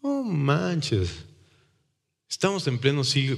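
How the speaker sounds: mocking scoff. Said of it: "me dijo".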